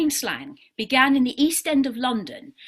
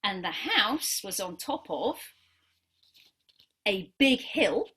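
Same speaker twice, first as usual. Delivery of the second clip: story narration; 3 semitones lower, 4 dB quieter than usual. Acted. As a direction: tell it gently, like a picture story. Tells the dog-drawing story.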